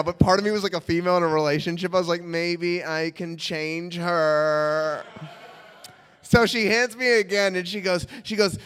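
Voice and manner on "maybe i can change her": very slow, drawn-out musing; wishful hope. Retold or imitated. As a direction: stretch it out like a hopelessly devoted lover. Hopeful lover's thought, acted.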